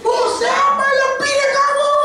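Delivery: very high, slow mocking shout; shrill mocking demand. The crowd mocking Moses, very high and slow. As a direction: screech the question at him.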